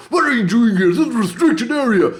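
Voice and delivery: hammed-up sergeant shout; mock drill sergeant. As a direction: challenge the intruder like a drill sergeant. Voicing the drill sergeant guy.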